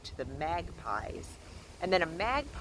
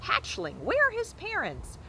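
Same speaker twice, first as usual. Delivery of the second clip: very high, louder squawk; squawking goose; 5 dB louder than usual. A narrator's reading. Old goose squawking at the robot, scolding. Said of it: "squawking at the robot".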